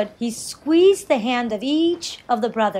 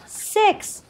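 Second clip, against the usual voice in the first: roared; 10 semitones higher than usual. A narrator's reading. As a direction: exclaim it high, a restrained storybook roar. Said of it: "roared the sixth brother".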